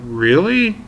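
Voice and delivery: high loud disbelieving question; can't believe what you just heard; incredulous really. Retold or imitated. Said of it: "I was like".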